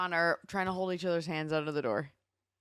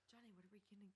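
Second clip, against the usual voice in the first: holding down a pet; 35 dB quieter, much faster than usual. Real, not acted. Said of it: "She's wrestling a cat".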